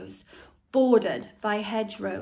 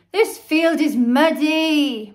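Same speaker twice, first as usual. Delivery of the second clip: moan; 6 semitones higher, 10 dB louder, slower than usual. A narrator's reading. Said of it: "moaned Henry".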